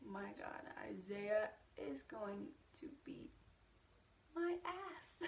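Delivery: hushed, breathless whisper of alarm; whispered panic. Retold or imitated. Her inner panic about the jealous ex, whispered. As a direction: whisper it like you're in trouble. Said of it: "And I was like".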